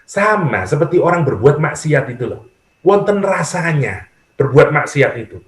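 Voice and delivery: normal talking voice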